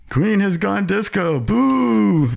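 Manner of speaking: high, drawn-out booing jeer; mock crowd jeer. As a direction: jeer it like a disappointed fan. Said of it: "people were screaming".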